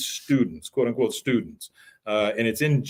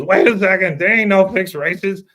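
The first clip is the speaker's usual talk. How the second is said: higher, louder indignant protest; Jimmy blurting it out; mock objection; 8 semitones higher, 8 dB louder than usual. Jimmy objecting to Peggy's plan, higher indignant protest.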